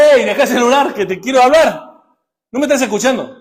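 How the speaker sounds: God trying to get your attention; God calling out. Retold or imitated. God telling a distracted adult to put the phone down, loud call. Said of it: "que Dios le dice".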